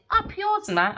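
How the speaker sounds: high-pitched mock brush-off; retold defiant retort. Me to him while walking off, retold defiant retort. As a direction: toss back a high, cheeky insult. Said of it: "And I'd be like this".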